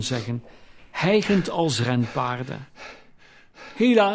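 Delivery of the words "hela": call out. A narrator's reading. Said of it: "riep de oude Fezziwig".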